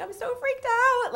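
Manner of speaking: very high, slow wail; freaked out. Retold or imitated. To the interviewer, spooked, high drawn-out wail. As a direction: stretch it out, spooked and giddy.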